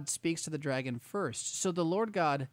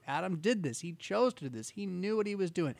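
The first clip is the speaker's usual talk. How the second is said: normal talking voice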